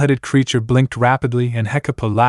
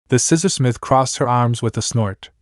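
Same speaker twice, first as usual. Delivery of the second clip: ordinary delivery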